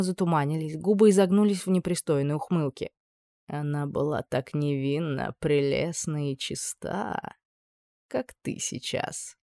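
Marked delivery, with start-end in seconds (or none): 3.5-9.4 slow, soft, lecherous villain; lecherous murmur. A narrator's reading. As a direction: savour each word, fading to a whisper. Villain savours memory.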